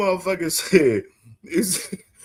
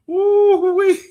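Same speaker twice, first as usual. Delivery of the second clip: high laughing whoop; 4 dB louder than usual. Real, not acted. Cracking up again.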